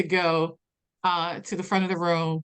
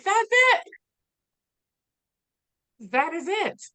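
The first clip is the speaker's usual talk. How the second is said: sudden revelation; 12 semitones higher than usual. Retold or imitated. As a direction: burst out with the realization. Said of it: "I was like".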